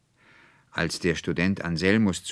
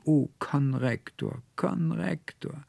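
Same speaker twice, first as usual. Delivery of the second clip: much higher, slow, anguished wail of the name; heartbreaking cry; 11 semitones higher, slower than usual. Acted. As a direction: cry it out as if your heart breaks. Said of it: "rief noch einmal ganz herzbrechend".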